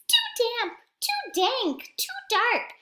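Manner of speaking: high squeaky little mouse voice. Acted. Mouse complaining about the cave in a high squeak. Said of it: "Mouse squeaks".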